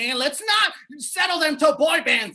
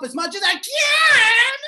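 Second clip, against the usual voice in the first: hyped-up fanboy; 7 semitones higher, 6 dB louder, slower than usual. Pretend. Fanboy demonstrates his hyping.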